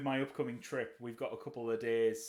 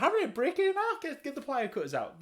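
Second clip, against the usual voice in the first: very high, loud comic exasperation; mock exasperated advice; 13 semitones higher, 5 dB louder than usual. Joking with friend about the ring, high loud mock exasperation. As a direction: shout the obvious fix in jest.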